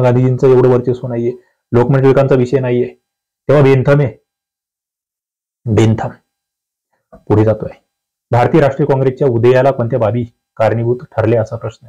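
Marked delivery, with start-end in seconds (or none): none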